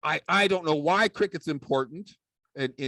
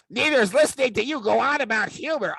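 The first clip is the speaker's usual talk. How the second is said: high, fast talking back; puppet sidekick; 7 semitones higher, 3 dB louder, faster than usual. Acted. Puppet sidekick talks back to Dave, high and fast. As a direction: shoot back quick and high.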